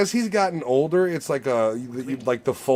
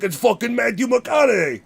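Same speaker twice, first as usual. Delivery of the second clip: higher, shouted realization; retold amazed shout; 7 semitones higher than usual. Joey Diaz realizing who the Southern guy is, amazed shout. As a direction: blurt out the discovery. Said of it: "And he's like".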